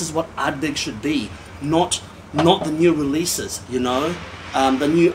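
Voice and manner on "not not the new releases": ordinary delivery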